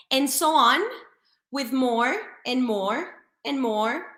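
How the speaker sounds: usual speaking voice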